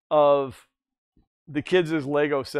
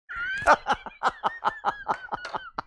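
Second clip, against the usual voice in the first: laugh. Real, not acted. Laughs after singing.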